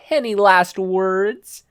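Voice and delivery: slow, high, loud taunt; menacing thug. Acted. Thug dangling Izuku over the hole, slow menace. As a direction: draw it out cruelly.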